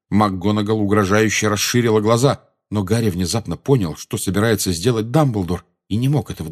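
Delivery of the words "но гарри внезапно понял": usual speaking voice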